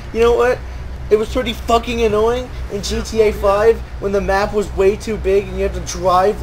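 ordinary talk

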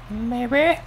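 "Maybe?" shot up high in desperate hope; pleading maybe. Real, not acted. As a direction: beg the game with a high "Maybe?". Hoping for a crate, rising.

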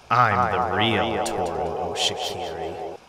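slow, low menacing declaration; a creepy double claiming your name slowly; sinister double. Acted. Doppelganger claims identity.

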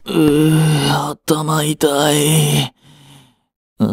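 slow, pained groaning; groggy groan. Acted. Waking up with a headache, slow groggy groaning. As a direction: drag it out with a headache. Hangover next morning.

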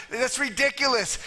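ordinary talk, nothing marked

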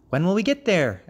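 high little traveller's question; impatient frog child. A narrator's reading. Young frog on the long trek, high impatient asking. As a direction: ask it high, like a restless child.

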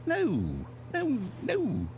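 high, drawn-out noes; dismayed. Acted. Narrator finding they are back at the office, high dismay. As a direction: refuse to believe it, pitched high.

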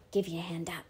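soft, low-key mutter. A narrator's reading. Jem offering Dill a lift to the window, muttered. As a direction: offer it under your breath. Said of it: "he muttered to Dill".

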